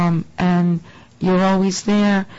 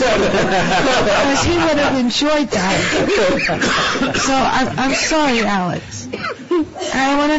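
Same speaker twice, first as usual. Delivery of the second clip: laughing; 4 semitones higher, slower than usual. Real, not acted. Teasing departing colleague, laughing.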